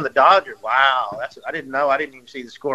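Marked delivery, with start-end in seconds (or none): none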